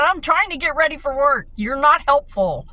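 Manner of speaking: yelling at her dad to let her get ready for work; snapping at dad. Retold or imitated. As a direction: vent the fury of a cornered caregiver. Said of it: "I just snapped".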